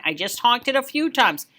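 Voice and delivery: usual speaking voice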